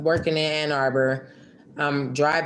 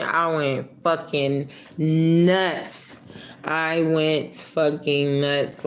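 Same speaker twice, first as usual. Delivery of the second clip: slow emphatic; much slower than usual. Real, not acted. Emphatic recall of her breakdown.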